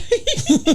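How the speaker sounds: high, loud cackle; high, loud laugh. Real, not acted. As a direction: burst out laughing mid-story. Laughing at the reveal.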